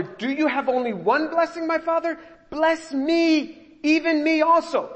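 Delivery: high, loud desperate plea; anguished cry. Retold or imitated. Grown hunter weeping before his father, high anguished cry. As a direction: cry out to your father, breaking. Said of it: "That's the fourth time he cries out".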